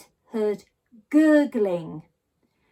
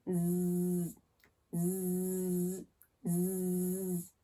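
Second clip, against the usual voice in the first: low snoring zzz, much softer; 3 semitones lower, 8 dB quieter, much slower than usual. A narrator's reading. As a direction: snore gently like a sleeper. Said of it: "snoring like a steam train".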